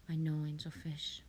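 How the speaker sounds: soft whispered reply; hushed whisper to a viewer. Real, not acted. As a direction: breathe it to them. Quiet reply to chat.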